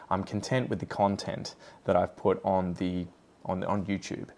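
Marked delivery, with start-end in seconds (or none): 0.1-1.5 first "content" cun-TENT, second "content" CON-tent; contrasting stresses. Shown as a demonstration. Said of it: "we've just switched the stress".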